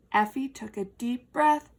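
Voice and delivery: plain reading voice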